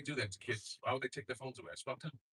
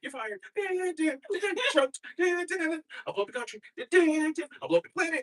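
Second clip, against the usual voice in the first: much higher, rising to loud boast; mock Trump announcer; 6 dB louder than usual. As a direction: announce doom like a hammed-up villain. Trump entrance bit.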